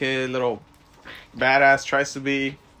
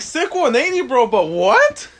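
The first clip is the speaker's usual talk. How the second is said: much higher, louder, slowing on the last words; mock stunned; 9 semitones higher, 6 dB louder than usual. Fan recalls reacting to the twist, mock stunned. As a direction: stretch out the disbelief. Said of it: "I was like".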